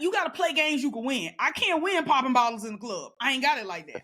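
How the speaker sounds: ordinary talk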